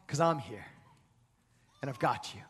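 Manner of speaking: soft and low, tender; reassuring. Retold or imitated. God comforting his frightened child, tender and hushed. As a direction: hold them close with the voice.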